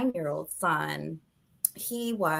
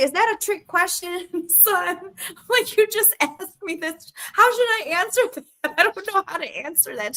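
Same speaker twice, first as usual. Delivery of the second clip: high, laughing, caught off guard; flustered laugh; 10 semitones higher than usual. Retold or imitated. Mother answering son's boyfriend question, high and laughing. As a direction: squirm at a tricky question. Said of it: "I say".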